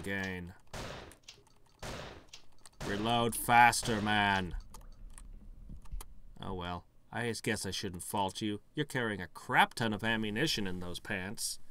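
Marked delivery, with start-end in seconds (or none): none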